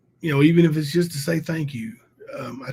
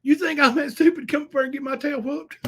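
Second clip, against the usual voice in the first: a higher whiny mock voice; mock whiny rival; 11 semitones higher than usual. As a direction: lightly whine it like the coward. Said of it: "He was thinking".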